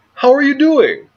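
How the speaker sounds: high, bright-eyed mock hello; mock cheery greeting. Quoting Trump greeting a prime minister, mock cheery and high. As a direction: chirp the greeting in a mocking Trump voice.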